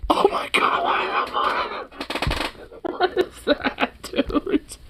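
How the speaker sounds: breathless, unvoiced gasp of horror; zooming in on the burger, whispering in horror; horrified whisper. Real, not acted. A shrieking whisper, almost crying.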